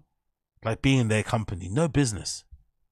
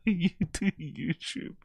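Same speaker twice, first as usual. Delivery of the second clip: high, slow, softer mocking voice; mock sneer; 8 semitones higher, 5 dB quieter, slower than usual. Mocking the podcast jab at Brendan, slow and high. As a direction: drag it out with a sneer. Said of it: "mocking line, slower".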